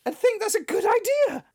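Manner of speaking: high-pitched disbelief; incredulous. Real, not acted. Co-host baffled by the audition plan, incredulous. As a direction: shoot up high in sheer bafflement.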